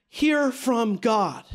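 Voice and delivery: "hear from God" slowed right down; slow emphasis. Shown as a demonstration. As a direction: lean on each word of "hear from God", unhurried. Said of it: "You get what I'm saying?".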